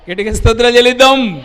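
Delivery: loud, high, fast call to praise; preacher urging loud praise; rallying call. Real, not acted. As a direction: fire up the congregation. Preacher rallies congregation.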